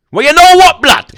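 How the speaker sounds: high, loud, heated shout; shouted challenge to a rival; taunting shout. Real, not acted. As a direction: yell it as a taunt. Taunting rival over injury.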